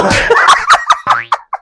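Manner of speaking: high, shrieking burst of laughter; delighted cackle. Real, not acted. Vlogger amused by the transformed look, delighted cackle. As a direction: crack up right after the reveal. Reacting to sudden change of look.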